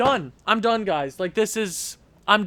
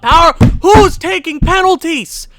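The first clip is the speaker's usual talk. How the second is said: much higher and louder shout; angry outburst; 9 semitones higher, 10 dB louder than usual. Real, not acted. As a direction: yell at the penalty-takers. Angry at penalties.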